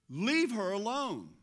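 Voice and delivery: loud, high, slow rebuke; forceful command. Acted. Preacher voicing Jesus defending the woman, forceful command. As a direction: order them off with firm, drawn-out authority.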